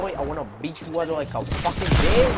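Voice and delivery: loud, aggressive threat; angry trash talk. Real, not acted. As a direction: snarl it at the enemy. Gamer trash-talking an enemy.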